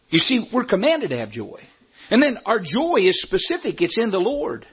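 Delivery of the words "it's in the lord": ordinary talk, nothing marked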